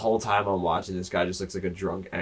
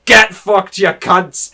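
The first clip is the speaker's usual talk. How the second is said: loud, high taunting yell; taunting shout; 11 semitones higher, 14 dB louder, much slower than usual. Retold or imitated. Guy filming from above taunts the cocaine users, shouting. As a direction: yell it down at them gleefully. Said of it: "he goes".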